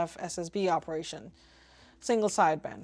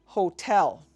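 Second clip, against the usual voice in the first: "hotel" with TEL punched louder; stress on second syllable; 6 dB louder than usual. Shown as a demonstration. Teaching phonetic alphabet pronunciation, "hotel" stressed on TEL. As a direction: hit the end of "hotel", ho-TEL. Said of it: "with an emphasis on tel".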